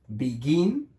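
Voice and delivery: "begin" with the stress on GIN; be-GIN, second syllable of "begin" lifted. Shown as a demonstration. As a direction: lean on the last syllable of "begin". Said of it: "el acento está en el gin".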